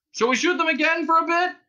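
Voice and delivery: very high, loud question; shouting. A narrator's reading. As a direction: shout the suggestion to a partner. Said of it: "One cop shouted to the other".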